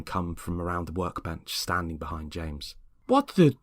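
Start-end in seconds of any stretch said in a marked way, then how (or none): 3.1-3.6 jumps much higher in disbelief; shocked exclamation. Acted. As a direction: blurt it as the sight hits you. Neighbours see the statue.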